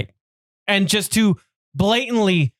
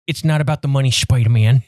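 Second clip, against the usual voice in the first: Kingpin quote; 7 semitones lower than usual. Retold or imitated. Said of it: "in the words of Kingpin".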